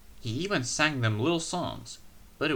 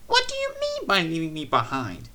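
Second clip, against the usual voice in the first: higher, indignant lady voice; demanding lady; 6 semitones higher, 3 dB louder than usual. A narrator's reading. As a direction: demand an answer, cross. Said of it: "she demanded".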